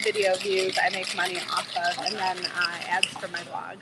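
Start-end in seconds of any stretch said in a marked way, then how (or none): none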